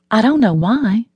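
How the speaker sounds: ordinary delivery, nothing marked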